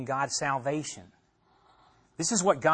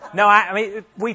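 laughing; 5 semitones higher, 8 dB louder than usual. Real, not acted. Laughs at a listener's definition.

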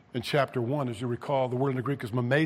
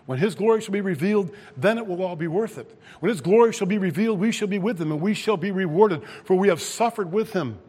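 high, loud preacher's proclamation; forceful preaching; 5 semitones higher, 6 dB louder than usual. Acted. Preacher promises the congregation glory with Christ, forceful preaching. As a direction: build the promise with raised, driving force.

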